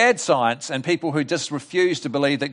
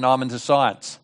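low, offhand brush-off; dismissive quote; 4 semitones lower than usual. Retold or imitated. Sceptic turning down the preacher's gospel, low and offhand. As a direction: brush off religion in a lower, matter-of-fact voice. Quoting people who dismiss religion.

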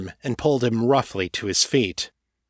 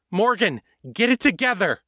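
urgent command; 11 semitones higher than usual. Acted. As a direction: shake her out of it with your voice. Kate shaking panicking Morgan.

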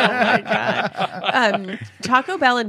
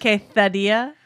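lisped Castilian Spanish "Quesadilla"; Spanish accent; slower than usual. Acted. Repeating the order for a puzzled friend, Spanish accent. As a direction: say "Quesadilla" with a Spain-style th. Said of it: "Like you're from Spain?".

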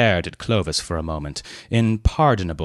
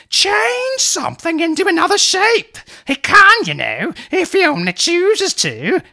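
put-on much higher pleading old lady voice; pleading aunt; 5 dB louder than usual. A narrator's reading. Aunt coaxing Leonard to show his powers, high pleading. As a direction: beg her nephew in a high fluttery aunt's voice. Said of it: "she informed the company".

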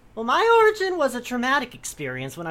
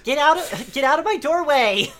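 yelling big sister; angry older sister; 6 semitones higher, 3 dB louder than usual. Acted. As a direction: snap at the pest. Acted sister quarrel scene.

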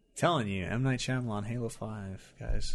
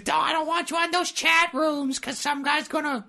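high, far-off mock parent warning; nagging parent voice. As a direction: play a worried parent lecturing their kid. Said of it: "didn't your parents ever give you guys".

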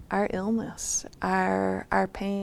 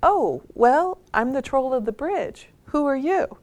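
higher voice, swooping, mildly startled reply; a little taken aback; 6 semitones higher, 4 dB louder than usual. Retold or imitated. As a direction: answer as a troll caught off guard. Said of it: "is a little taken aback and says".

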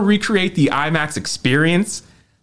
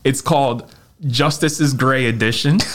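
ordinary talk

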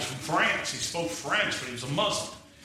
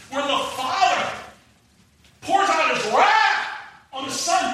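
preaching at full force; 12 semitones higher, 5 dB louder than usual. Acted. Preacher thundering to the congregation, at full force. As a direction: drive each phrase home from the pulpit.

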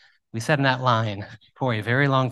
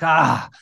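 frustrated groan; 4 semitones higher, 6 dB louder than usual. Retold or imitated. Frustration at backwards bracket.

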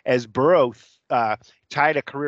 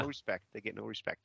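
much softer comic complaint, muttered impression; Rodney Dangerfield; 3 semitones lower, 15 dB quieter, faster than usual. Host quoting a comedian about the Vikings, muted mock complaint. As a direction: lightly imitate a put-upon comedian. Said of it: "Rodney Dangerfield".

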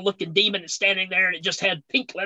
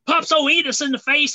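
usual speaking voice

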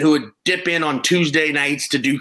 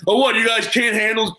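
cocky taunt; 6 semitones higher than usual. Retold or imitated. Young comic mocking the crowd.

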